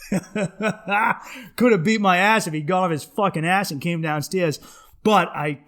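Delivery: ordinary delivery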